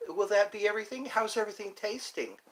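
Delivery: lightly mimicked chirpy waitress; a hint of a waitress asking politely.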